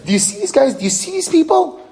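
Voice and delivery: sea's mocking complaint. The sea mocks the people to God in a high scornful voice. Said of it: "It's not an exact quote".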